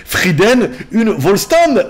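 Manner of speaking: emphatic mock German delivery; put-on German accent. Reading the sister's sign-off in German, emphatic mock German accent. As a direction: read it out in a hammed-up German accent. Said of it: "le voici en allemand".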